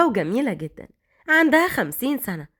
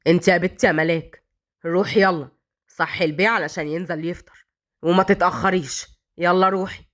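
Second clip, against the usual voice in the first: low, harsh scolding voice, lower pitch; sharp mother-in-law; 6 semitones lower than usual. A narrator's reading. Cruel mother-in-law ordering Malak upstairs, harsh and low. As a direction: bark orders like a cruel old woman. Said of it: "وقالت بحدة".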